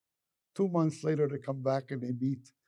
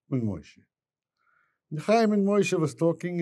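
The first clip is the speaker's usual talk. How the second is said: normal talking voice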